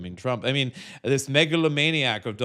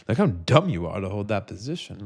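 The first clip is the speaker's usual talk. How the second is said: usual speaking voice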